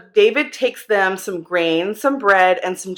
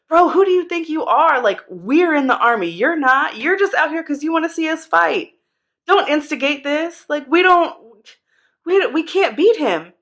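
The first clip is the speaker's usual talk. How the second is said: higher, scolding big-brother rant; mock angry brother; 6 semitones higher than usual. Older brother scolding David for wanting a fight, in a mock angry rant. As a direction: tell him off like an annoyed older brother. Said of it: "he gets mad and he's like".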